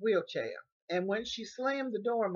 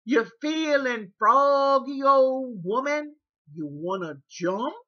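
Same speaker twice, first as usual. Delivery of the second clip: taunting caregiver; 6 semitones higher, 9 dB louder, slower than usual. Retold or imitated. Caregiver threatening a fight.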